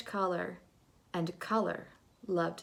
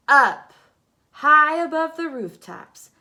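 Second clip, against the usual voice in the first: much louder, higher, thrilled; soaring; 7 semitones higher, 17 dB louder than usual. A narrator's reading. Narrator as Swatch soars above rooftops, much louder and higher. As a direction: lift the voice into the sky.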